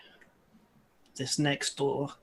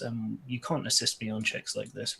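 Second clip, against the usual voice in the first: usual speaking voice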